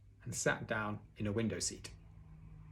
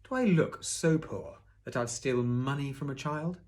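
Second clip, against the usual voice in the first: thief feigning innocence; 4 semitones higher, 4 dB louder than usual. A narrator's reading. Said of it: "the thief had only to say".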